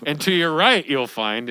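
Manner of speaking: cheery tour guide pointing things out. Shown as a demonstration. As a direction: announce it like a guide leading a group. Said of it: "I'm doing my tour guide voice".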